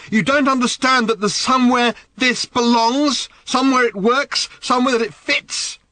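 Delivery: high, loud brandishing yell; angry shout. Acted. Daughter brandishing the watch, shouting at her father. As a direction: fling it at him, raging. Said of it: "her face dark with anger".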